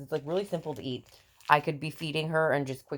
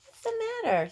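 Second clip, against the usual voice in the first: mother talking to her infant, high and sing-song. Real, not acted. Mom cooing to her baby.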